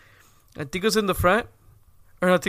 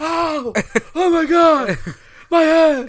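high mock wail, getting louder; hurt kid's outcry; 9 semitones higher, 7 dB louder, much slower than usual. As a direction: wail like a kid who banged his head. Retold boy hitting his head.

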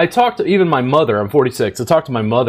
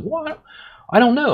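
Kristen's line pitched far higher; Kristen's shy reply; 11 semitones higher, faster than usual. Retold or imitated. Colleague Kristen answering his question, mock shy high voice. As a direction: play a modest colleague caught off guard.

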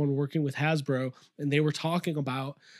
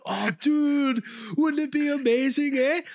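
hammed-up Canadian accent, higher pitched; mock Canadian accent, higher; 5 semitones higher than usual. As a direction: play an excited Canadian fan with "eh"s, pitched up. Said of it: "I'll just do my Canadian voice".

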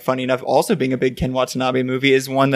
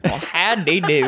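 cowboy twang; 3 semitones higher, slower than usual. Pretend. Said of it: "I don't do a good Western".

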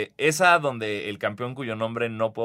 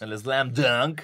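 slow, drawn-out event announcer voice; hammed-up sponsor announcement; mock announcer; much slower than usual. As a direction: stretch each sponsor name like a hype man. Mock announcer reading sponsor-heavy contest name.

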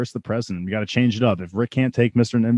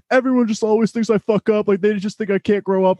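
high, whiny complaint; acted rant; 11 semitones higher than usual. Morty ranting to his mom, acted complaint. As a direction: rattle off grievances in a raised pitch.

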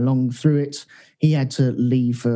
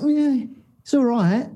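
drawn-out, higher, unimpressed shrug of a voice; mock lukewarm review; 11 semitones higher, slower than usual. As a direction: parody a bored reviewer, slow and high. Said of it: "it's, it's like".

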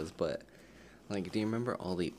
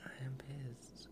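whispering; 7 semitones higher, 9 dB quieter, slower than usual. Real, not acted. Reacting to a photo.

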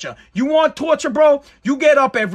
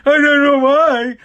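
mock crying; 3 semitones higher, much slower than usual. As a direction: ham up a rich man's self-pity. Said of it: "sitting in your mansion being like".

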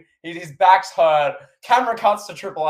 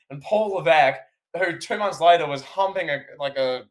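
ordinary talk

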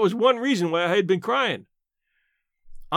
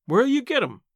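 sharp, higher demand; aunt challenging Mark, demanding and higher; 6 semitones higher than usual. A narrator's reading. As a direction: press him for an answer. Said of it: "demanded Aunt Philippa".

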